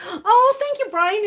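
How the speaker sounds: thanking a viewer, overcome and tearful; overwhelmed thanks. Real, not acted. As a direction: cry out the thanks, choked with joy.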